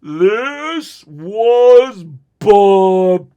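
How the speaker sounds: drastically stretched, loud slow-motion voice; loud, drawn-out slow-mo replay. Shown as a demonstration. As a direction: drag out every word like slowed-down tape. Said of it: "but in slow motion".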